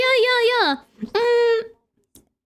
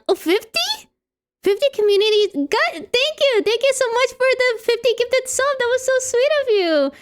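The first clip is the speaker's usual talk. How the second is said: pleasantly surprised; 6 semitones higher than usual. Real, not acted. Surprise gift of fifty subs.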